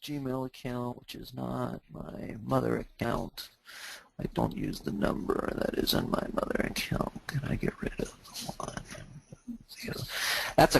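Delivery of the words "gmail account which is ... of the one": softer, lower near-whisper; reading under breath. Real, not acted. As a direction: murmur it to yourself as you read. Reading viewer's question to himself.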